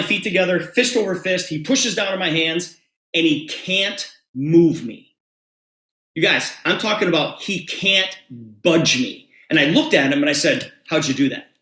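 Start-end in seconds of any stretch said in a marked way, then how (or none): none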